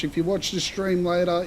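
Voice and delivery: ordinary delivery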